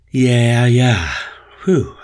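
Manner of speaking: mildly shaken, catching breath; shaken relief. A narrator's reading. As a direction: let out the scare. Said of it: "feeling entirely shook up".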